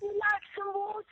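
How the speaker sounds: put-on high, playful British accent; imitated British accent. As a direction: echo a waiter in mock British. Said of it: "so proper sounding about the British accent".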